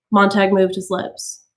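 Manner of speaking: ordinary delivery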